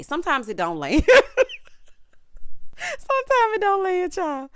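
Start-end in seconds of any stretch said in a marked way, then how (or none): 1.1-1.9 loud, high-pitched cackle; burst of laughter. Real, not acted. Cracks up at failed line. 2.8-4.5 high, squeezed out through laughter; laughing. Real, not acted. Repeats it while cracking up.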